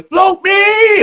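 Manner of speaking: high, loud yell of Float me; screaming. Retold or imitated. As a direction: shout for the ball across the court. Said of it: "I'm screaming".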